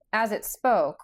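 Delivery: ordinary delivery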